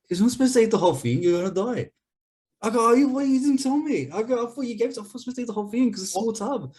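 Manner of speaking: high-pitched, fast, incredulous protest; worked-up voice recounting a brother's shock; exasperated retelling. Retold or imitated. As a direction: replay the disbelief, pitch way up. Heated retelling of ice cream argument.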